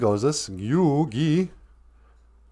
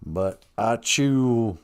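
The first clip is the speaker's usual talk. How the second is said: mock southern accent, stretched-out phrases. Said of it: "I can't have a southern accent".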